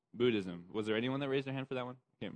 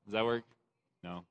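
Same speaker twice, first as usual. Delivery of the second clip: ordinary delivery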